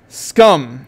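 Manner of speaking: loud spat insult; shriek. A narrator's reading. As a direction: fling the word at him. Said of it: "she shrieks".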